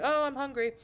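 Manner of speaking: sudden hungry outburst; sudden exclamation. Real, not acted. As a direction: blurt it out, interrupting your own reading. Breaks off reading to exclaim.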